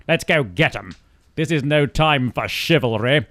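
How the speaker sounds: ordinary talk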